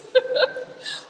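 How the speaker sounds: high unvoiced giggle; breathless giggle. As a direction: let out a gleeful airy laugh. Excited after the offer.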